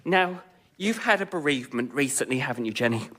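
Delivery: soft, sympathetic medium. Acted. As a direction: ease her into grief gently.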